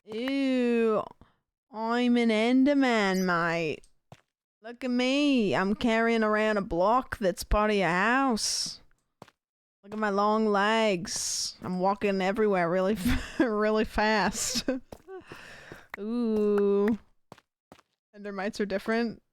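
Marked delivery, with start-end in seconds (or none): 0.0-1.0 drawn-out mock Australian accent; Enderman accent. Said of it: "Oh, like an Enderman accent?". 1.7-3.8 slow, hammed-up Australian accent; mock Aussie Enderman, stretched out. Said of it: "Oh, like an Enderman accent?". 4.6-17.0 drawn-out mock Australian accent; Enderman accent. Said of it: "Oh, like an Enderman accent?".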